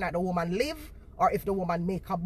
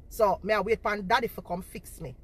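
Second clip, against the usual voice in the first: normal talking voice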